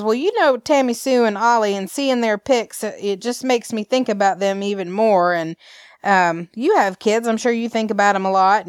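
playing Clyde with a mild Alabama twang; Alabama accent. Clyde on the phone to Kate, in a mild Alabama accent. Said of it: "let my Alabama hang out".

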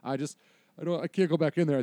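lightly acted tearful protest, stumbling at the end; mock near-crying kid. Kid refusing his dad in the van, near-tearful. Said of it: "he's pretty much crying".

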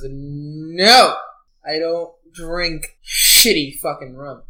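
loud drawn-out no, then slow emphatic words; indignant refusal. Acted. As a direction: refuse the drink loudly and emphatically. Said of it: "and goes".

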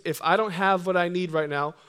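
normal talking voice

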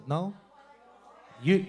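usual speaking voice

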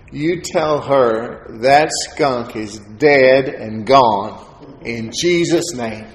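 slow, deliberate, every word weighted; firm declaration. Retold or imitated. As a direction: pronounce it like a verdict of faith.

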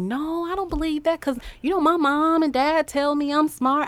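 high-pitched schoolkid voice; confident child. Retold or imitated. Counselor voicing a bullied student who believes in themselves, confident.

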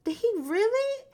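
high, loud, stunned disbelief; can't believe he just laughed at me; indignant disbelief. Retold or imitated. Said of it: "And I was just like".

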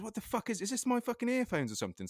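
sudden high startled outburst; startled disbelief. Retold or imitated. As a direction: blurt it out, half asleep and alarmed. Startled by noise in bed.

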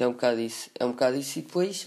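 ordinary talk

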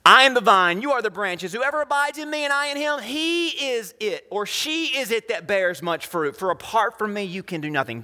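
high proclamation, easing down at the end; preaching scripture. Acted. Preacher quoting the vine and branches, high declamation. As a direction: open strong and high, then gradually settle. Preacher voices Jesus's words.

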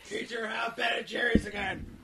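mock shouted order. Calling Kreacher for ice cream, mock shout. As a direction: bellow it like summoning a servant.

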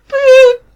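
high beep. Pretend. Mock phone hangup beep.

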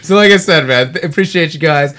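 high, loud tipsy rambling; slurring a little, giggly. Real, not acted. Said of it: "I'm a little tipsy right now".

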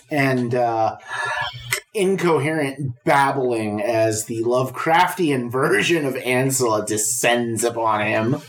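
ordinary delivery

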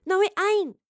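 loud, high-pitched objection; indignant outburst; protesting. A narrator's reading. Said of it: "Astrid protested".

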